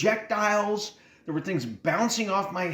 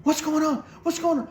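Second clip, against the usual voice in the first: panicked mom; 13 semitones higher than usual. Retold or imitated. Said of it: "Mom comes straight over".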